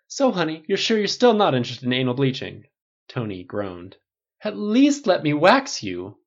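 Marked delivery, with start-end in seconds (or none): 0.1-2.6 louder bright campy pitch. A narrator's reading. Stylist brightly pitching a treatment to Tony. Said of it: "asked brightly". 4.4-6.2 high, loud, slow wheedle; campy pleading. Acted. Stylist wheedling Tony into a wax.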